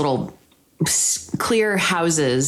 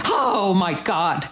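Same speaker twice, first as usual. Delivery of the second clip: slow shocked gasp; much slower than usual. Retold or imitated. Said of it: "I was like".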